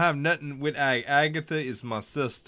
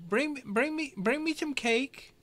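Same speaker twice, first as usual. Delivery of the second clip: high calling voice. Acted.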